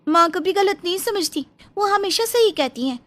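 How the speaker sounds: ऊँची आवाज़ में तुनकती जवान बहू; सास का पक्ष लेकर पड़ोसन को ऊँची आवाज़ में ललकारो; बहू प्रिया की आवाज़. Acted. (पड़ोसन से भिड़ती बहू, ऊँची तीखी आवाज़ में)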